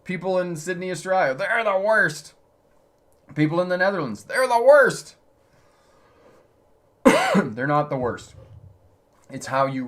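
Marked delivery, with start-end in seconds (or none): 0.0-5.2 lightly mocking whiny complainer, pitched up; mock complaining. Instructor mimicking drivers blaming other cities, mock whine, higher. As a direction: imitate people griping about other drivers. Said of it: "I've heard these stories".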